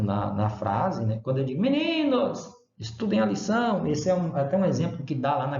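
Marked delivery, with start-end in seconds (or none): 1.6-3.9 high-pitched vocative call, like a teacher addressing pupils. Shown as a demonstration. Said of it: "estou chamando mesmo a pessoa".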